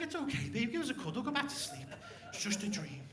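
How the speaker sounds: soft, hushed comfort to a partner; softly reassuring girlfriend after her bad dream; soothing whisper. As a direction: murmur it gently, half asleep.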